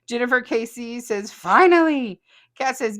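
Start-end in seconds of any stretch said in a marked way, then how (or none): 1.3-2.2 relieved cheer. Retold or imitated. Reading a relieved fan comment.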